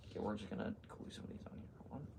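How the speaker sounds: quick soft whisper. Real, not acted. Whispering while working.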